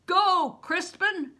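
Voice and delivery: loud, high urgent shout; crying out. Acted. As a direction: yell at him to run. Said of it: "Bear cried".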